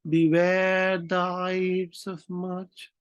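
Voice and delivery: very slow, high, drawn-out chant; soothsayer's warning. Acted. Fortune teller warns Caesar, long high intoned cry. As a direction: stretch every vowel into a long wail of prophecy. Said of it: "वही fortune teller बोल रहा है".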